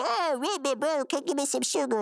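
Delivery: high put-on coaxing; hug-happy brother. Brother asking his reluctant sibling, high mock pleading. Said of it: "And he said".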